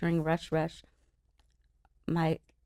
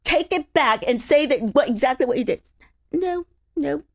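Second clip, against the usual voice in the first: mock demand; 8 semitones higher, 5 dB louder than usual. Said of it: "'cause I go".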